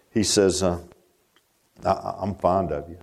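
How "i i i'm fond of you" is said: low, subdued acted reply; humbled, hesitant Peter. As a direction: answer modestly, afraid to boast. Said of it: "he was afraid to boast".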